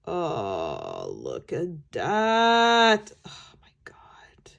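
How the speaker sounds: low grunt, then whispered sigh. Real, not acted. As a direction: groan with delight at the colour. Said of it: "I'm sorry for all the grunting and sighing".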